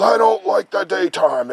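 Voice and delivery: loud, high grumpy giant voice at normal pace. Retold or imitated. Giant complaining to the son about daytime, loud and grumpy. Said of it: "And the giant says".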